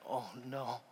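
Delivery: very soft, low, breathy-quiet oh no; hushed dismay. Retold or imitated. Pastor stunned by the boy's story, hushed. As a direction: let it fall out almost silently. Hearing the kid's troubles.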